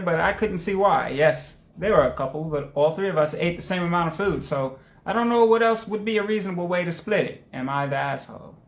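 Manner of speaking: usual speaking voice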